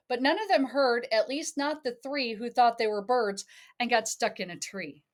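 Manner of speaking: lilting read-aloud storybook voice; sing-song picture book reading; reading to a child. Acted.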